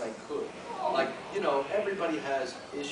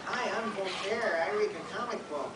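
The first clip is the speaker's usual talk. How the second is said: hapless self-introduction; 6 semitones higher than usual. Acted. Hapless cartoon self greeting convention guests, mock-eager.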